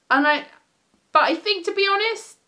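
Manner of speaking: ordinary talk, nothing marked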